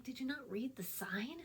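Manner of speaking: whispered disbelief, breathy and unvoiced; exasperated whisper. Former Lowe's worker whispering at customers who ignored signs. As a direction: hiss it under your breath at careless customers. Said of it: "And I'm like".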